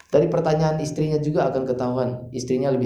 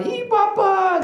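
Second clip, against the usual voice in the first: put-on excited child; much slower than usual. Child running to father.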